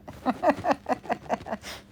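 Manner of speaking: rapid high-pitched laughter; high giggling laugh. Real, not acted. Laughing at own hospital story, high giggles.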